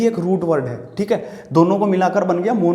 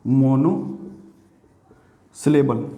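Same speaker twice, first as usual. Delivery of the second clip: "Monosyllable" in two parts, long pause after "mono"; lower, very slow "monosyllable", split for writing down; slow dictation; 4 semitones lower, much slower than usual. Shown as a demonstration. Teacher writing the next word for the class, slow dictation.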